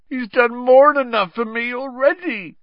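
high, loud anguished groan. Acted. Hagrid groans to Harry about Dumbledore, loud and high. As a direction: moan it out in misery. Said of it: "groaned Hagrid".

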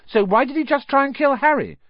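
indignant cry. A narrator's reading. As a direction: cry it out at Hagrid. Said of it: "cried Hermione".

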